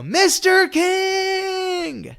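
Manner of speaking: long drawn-out high call across a parking lot; yelling. Retold or imitated. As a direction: stretch out Mister King as one long high cry. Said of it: "yelling uncontrollably at the top of my lungs".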